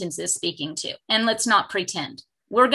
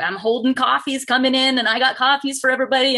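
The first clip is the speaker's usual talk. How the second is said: ordinary delivery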